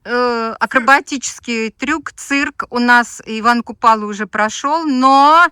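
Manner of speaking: loud showman announcing a stunt; booming circus ringmaster. Pretend. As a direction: boom it out like a ringmaster.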